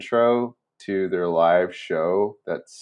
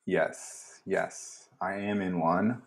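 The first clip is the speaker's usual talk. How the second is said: whispering; 8 dB quieter, much slower than usual. Real, not acted. Quiet aside after laugh.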